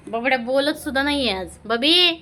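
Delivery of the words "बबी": high, loud pet call; raised, coaxing shout to pets. Real, not acted. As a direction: call across to the pets, pitched up. Calling out to the pets.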